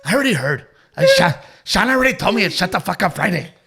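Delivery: loud, eager dad voice; imitating the dad. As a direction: play an excited dad repeating himself. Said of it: "your dad the next day was like".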